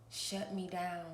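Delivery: ordinary talk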